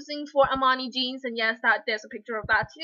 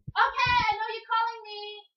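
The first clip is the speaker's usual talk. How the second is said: higher, projected call to another room; calling back; 8 semitones higher than usual. Real, not acted. Vlogger answers her sister calling her, called out.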